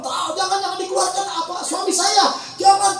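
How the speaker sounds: high, softened mock wailing widow; mock weeping. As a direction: imitate a distraught wife pleading over her husband. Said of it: "terus dia nangis". (pura-pura meratap, suara tinggi memelas)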